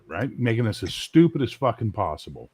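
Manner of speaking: ordinary talk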